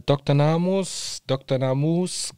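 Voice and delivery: Namus with a drawn-out long U, Naamuus. Shown as a demonstration. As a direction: stretch the U in Namus slowly. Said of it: "Ich hab's jetzt extra lang gesprochen, das U".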